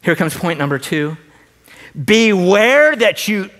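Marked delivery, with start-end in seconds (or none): 2.0-3.5 BEWARE stretched slow, high and loud; emphatic warning. Acted. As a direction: lean hard on "Beware". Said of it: "it's in the emphatic".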